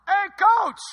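loud, high yell from a car. Retold or imitated. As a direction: call out across the street. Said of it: "He just yelled at me".

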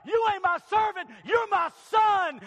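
loud, high shout, slowing down on son; joyful father's shout. Acted. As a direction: roar it out, overjoyed. Father answers the returning son.

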